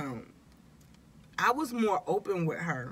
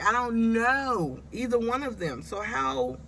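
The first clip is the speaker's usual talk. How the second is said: higher, louder mock protest; retold frustration, voice pushed up and louder; retelling her protest about choosing a doctor, raised and louder; exasperated retelling; 5 semitones higher, 3 dB louder than usual. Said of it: "I'm like".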